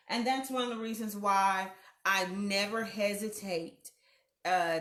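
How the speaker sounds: normal talking voice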